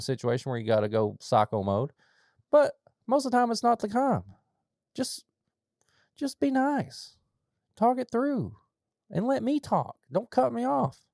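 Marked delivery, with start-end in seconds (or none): 2.5-11.0 much higher, pleading, a voice near cracking; begging them to just be nice, pitched way up; high strained plea. Acted. To a rude caller, high exasperated pleading.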